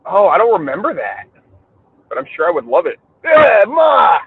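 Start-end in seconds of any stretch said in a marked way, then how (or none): 3.2-4.2 hammed-up Italian-American accent. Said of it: "stereotypical Italian family".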